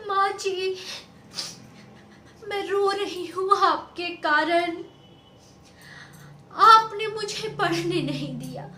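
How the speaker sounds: loud, high, tearful wail; crying complaint. Acted. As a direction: blame her mother through tears. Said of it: "रोते रोते वापस आती है". (रोते हुए ऊँची आवाज़ में शिकायत)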